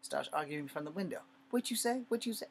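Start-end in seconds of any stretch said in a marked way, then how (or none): 1.5-2.5 much higher, mocking the trucker's challenge; mock angry driver. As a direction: throw his question back like the angry driver.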